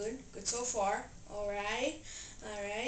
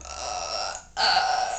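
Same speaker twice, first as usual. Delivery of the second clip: breathy burps; 4 dB louder, much slower than usual. Acted. Burping contest, burps.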